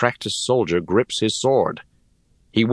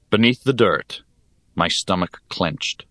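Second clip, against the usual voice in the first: ordinary delivery, nothing marked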